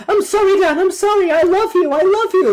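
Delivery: high-pitched, loud frantic begging; mock desperate high-pitched wail; pleading impression. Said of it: "like that".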